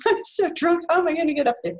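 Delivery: high, laughing inner thought; amused tipsy thought. Retold or imitated. Recalling climbing to the stage, laughing at herself. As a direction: play up the tipsy panic.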